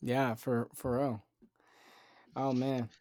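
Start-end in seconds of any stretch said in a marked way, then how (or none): none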